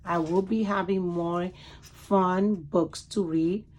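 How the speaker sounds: ordinary delivery, nothing marked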